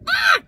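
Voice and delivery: short, high, loud "Ah!"; sharp re-enacted cry of pain; sudden pained yelp. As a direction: yelp as if the knee just gave a jolt. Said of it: "I went".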